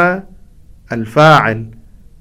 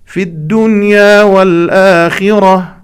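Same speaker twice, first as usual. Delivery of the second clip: louder, higher, slow chant of في الدنيا والآخرة; continuation phrase; 6 semitones higher, 6 dB louder, much slower than usual. Shown as a demonstration. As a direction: carry on into في الدنيا والآخرة as the completion. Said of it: "يتوقع المستمع أن لهذه العبارة تكملة". (تكملة العبارة بصوت مرتفع)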